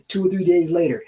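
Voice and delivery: ordinary talk, nothing marked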